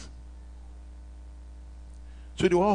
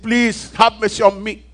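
slow, loud pleading cry to God, voice higher; a man recounting his shout from the edge of hell; retold desperate shout; 8 semitones higher, 6 dB louder than usual. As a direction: beg for another chance at the top of the voice. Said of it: "I was yelling at the top of my voice".

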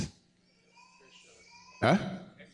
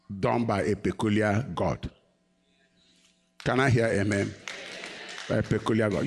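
usual speaking voice

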